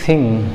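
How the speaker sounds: थिंग opening on an airy th, not a stop; native th sound. Shown as a demonstration. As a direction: say थिंग with tongue between teeth, air flowing out. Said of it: "और क्या पढ़ा जाएगा?".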